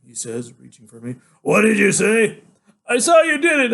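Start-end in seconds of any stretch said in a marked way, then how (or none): 1.4-2.4 high, loud, drawn-out challenge; loud demand. Acted. Father confronting son over accusation, loud challenge. 2.9-3.7 high, loud cry of accusation; shouted accusation. Acted. Son answering father's demand, high shouted accusation.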